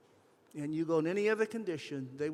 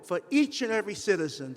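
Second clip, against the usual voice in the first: louder, rising passion, pressing every line; getting emotional; 3 semitones higher, 7 dB louder than usual. Real, not acted. Speaker urging the audience to fix the healthcare system, emotional and loud. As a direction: pour real feeling into the call to fix the system. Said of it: "I do apologize for getting emotional".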